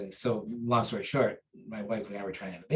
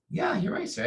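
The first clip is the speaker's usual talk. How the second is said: eager agreement; 10 semitones higher than usual. Retold or imitated.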